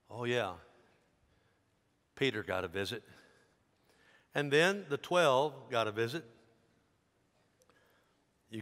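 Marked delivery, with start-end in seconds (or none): none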